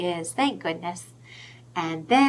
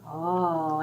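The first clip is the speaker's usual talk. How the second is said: ordinary delivery, nothing marked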